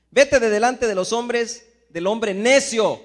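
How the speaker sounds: loud, slow, weighty verse reading; scripture read with force. Acted. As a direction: proclaim each word slowly and loudly. (lectura bíblica proclamada, fuerte y lenta)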